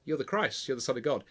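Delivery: soft, halting answer; tentative. Acted. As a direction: venture it quietly, unsure of yourself. Peter finally ventures an answer.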